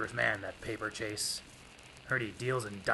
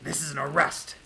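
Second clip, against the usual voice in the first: higher, loud police order; commanding shout; 7 semitones higher, 9 dB louder than usual. Acted. Detective springing the trap on Paperchase, shouting. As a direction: bark the arrest from cover.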